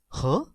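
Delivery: "Huh?" going up like a question; short upward "Huh?"; rising tone. Shown as a demonstration. Teacher says tone two sounds like this, rising "Huh?". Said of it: "It's a rising tone, going up".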